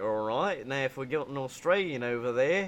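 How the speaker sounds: casual imitated Australian accent. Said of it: "this guy would be Australian".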